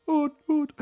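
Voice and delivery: mock echo. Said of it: "If I go like".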